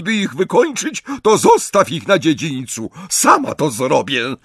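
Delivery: high-pitched, emphatic bossy order; scornful command. A narrator's reading. Bellatrix tells Draco to remove the snatchers, scornful. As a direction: order him about with contempt.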